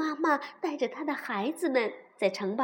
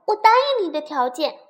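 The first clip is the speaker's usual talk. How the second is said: loud, hurried consent; calling out; 3 semitones higher, 8 dB louder than usual. A narrator's reading. Princess calls frog back accepting terms, loud. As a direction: shout agreement to someone turning away. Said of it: "小公主喊道".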